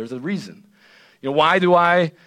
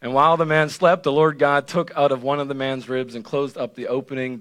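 usual speaking voice

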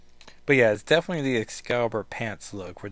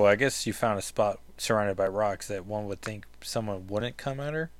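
ordinary delivery

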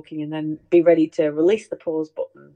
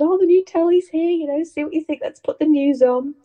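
high, bright-eyed fake innocence; mock innocent chirp; 9 semitones higher, 5 dB louder than usual. Teasing Dad with fake innocence, high and innocent. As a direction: play it sweet and innocent to fool Dad. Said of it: "And we said".

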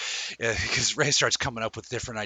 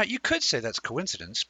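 ordinary talk

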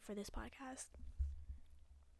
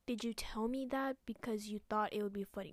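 normal talking voice

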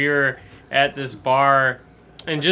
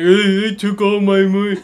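higher, louder, playful imitation; mock-innocent friend; 6 semitones higher, 6 dB louder than usual. Teller imitating his friend leaving the strip club. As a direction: imitate a guilty buddy shrugging it off. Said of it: "He's like".

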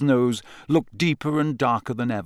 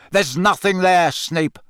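loud, higher, abrupt bark; 6 semitones higher, 4 dB louder than usual. A narrator's reading. Moody denying anyone is there, barked. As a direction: bark it to shut him down. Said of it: "barked Moody".

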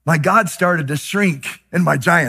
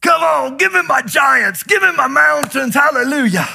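high, loud, fearless battle cry; bold old Caleb; 8 semitones higher, 3 dB louder than usual. Retold or imitated. Preacher voicing old Caleb demanding his giants, shouting. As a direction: shout it like an eager old warrior. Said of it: "wish he was your grandpa".

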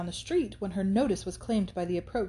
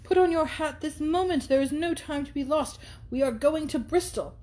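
higher, pushy and hurried; brash young man; 7 semitones higher than usual. Acted. Thorpe urges Catherine to leave now, brash and high. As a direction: bustle her out the door.

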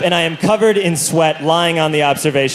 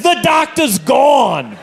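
curt shout; 7 semitones higher, much slower than usual. Retold or imitated. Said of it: "And Batman said".

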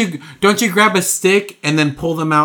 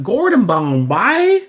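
slow, higher, drawn-out Southern "Gordon Bombay?"; Southern accent; 6 semitones higher, slower than usual. Retold or imitated. Old coach greets Gordon Bombay in Southern accent. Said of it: "I don't know why he's Southern".

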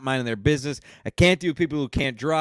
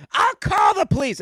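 high mock shout; imitating the angry park lady; old lady shouting; 3 dB louder than usual. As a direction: threaten like a shrill old woman. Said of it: "so she was".

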